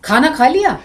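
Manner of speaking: high swooping peak on "लिया"; meaning by intonation. Shown as a demonstration. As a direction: pitch "खाना खा लिया" high, peak on "लिया", then drop. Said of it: "देखो कितने अर्थ हो गए".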